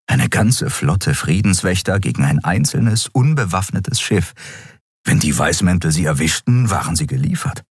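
usual speaking voice

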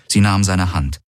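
plain reading voice

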